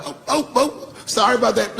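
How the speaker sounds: high-pitched flustered cop apology; high apologetic cops. Retold or imitated. Police backing off after learning the mayor knows him, high flustered apology. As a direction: squeak it out like startled police.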